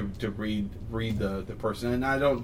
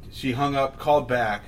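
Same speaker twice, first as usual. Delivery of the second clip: usual speaking voice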